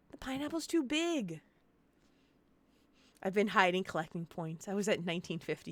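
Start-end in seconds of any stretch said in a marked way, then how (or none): 0.1-1.4 softer, deflated repeat of a playful complaint; subdued mock whine. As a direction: whine it softly, a little high, giving up. Same protest repeated, subdued.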